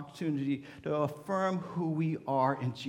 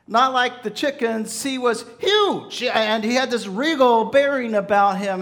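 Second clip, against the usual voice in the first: louder, higher preacher build over the eagle; preaching with force; 7 semitones higher, 11 dB louder than usual. Acted. Pastor telling the congregation about the strange bird, preaching with force. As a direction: preach it with rising awe.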